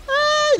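very high, loud shriek; startled yelp. Real, not acted. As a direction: yelp in sudden alarm. Startled during the race.

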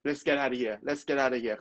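let's get out of here blurred into lesketa-da-hia; connected movie pronunciation drill; linked-up speech. Shown as a demonstration. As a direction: run each phrase together fast, twice. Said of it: "ผมก็พยายามพูดตามประโยคเนี้ย".

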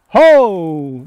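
sudden loud startled cry; loud surprised yelp at a wasp nest. Real, not acted. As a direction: jump back with a shout.

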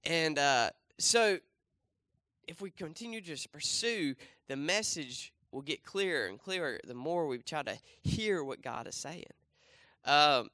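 ordinary talk, nothing marked

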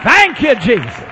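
loud thanks. Retold or imitated. Preacher coaching the praying lady, loud thanks. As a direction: call it out in thanks. Models the changed words.

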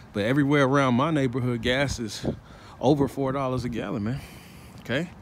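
ordinary talk

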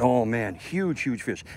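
ordinary delivery

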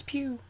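high, soft "pew"; a quick little mouth-made laser zap; laser gun noise. Pretend. Player mimicking generic laser gun sounds, playful. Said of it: "Generic laser gun sounds".

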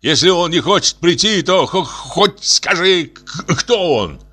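high-pitched agitated stammer; worked-up father stuttering; stammering shout. A narrator's reading. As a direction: stumble on the consonants while demanding an answer. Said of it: "кричал отец".

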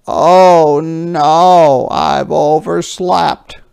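Jeffrey groaning at oversleeping. Acted. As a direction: groan it as you wake up too late. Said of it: "groaned Jeffrey".

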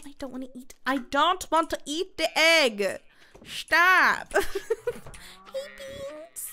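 ordinary talk, nothing marked

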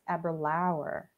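"Aberlour" drawn out to rhyme with flower; slow, soft "Aberlour", ending like "flower". Shown as a demonstration. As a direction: end "Aberlour" on an ow-er sound. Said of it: "it's like flower, rhymes with flower".

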